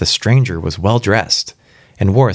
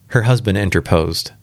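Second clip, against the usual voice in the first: plain reading voice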